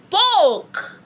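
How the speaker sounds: "spoke" high, loud and drawn out; playful big stress on "spoke"; emphatic stress. Shown as a demonstration. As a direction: punch "spoke" way up, then let it fall. Teacher hammering the translation.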